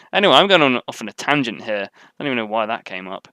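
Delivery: ordinary talk, nothing marked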